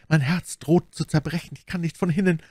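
high, distressed plea; anguished. A narrator's reading. Bonacieux tells Milady she cannot leave, anguished.

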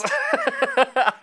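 high, loud burst of laughter. Real, not acted. Amused at the bag of threads.

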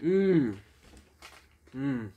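humming through closed lips; savoring a bite while eating; mouth full, chewing. Real, not acted. Seen 1: Cheeks puffed, chewing the bitten patty throughout the hums.

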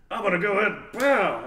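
loud, high mock punch; mock punch announcement. Storyteller acting out a pretend punch, loud and playful. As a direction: blurt it big and high, landing on the pow.

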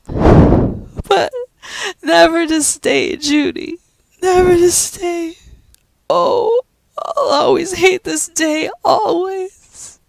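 high, slow tearful lament; crying. Acted. Pat crying to Judy over Joe leaving, sobbing. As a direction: sob out the grief.

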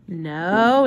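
ordinary delivery, nothing marked

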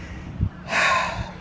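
long unvoiced sigh; heavy sigh. Real, not acted. At her cousin's gravesite, a heavy sigh. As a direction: let out a weary sigh.

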